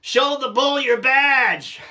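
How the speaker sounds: high, slow call across a field; yelling across the field. Retold or imitated. Rancher yelling to the inspector chased by the bull, high and slow. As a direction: shout the advice out to him. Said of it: "So I yelled out at him".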